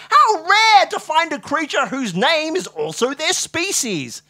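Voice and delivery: high, slow, loud wonderment; marvelling. A narrator's reading. Watson marvels at the doctor's name, slowly awestruck. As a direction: marvel at it with overblown astonishment.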